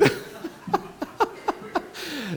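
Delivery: high airy laugh; breathy laugh. Real, not acted. After acted squeal.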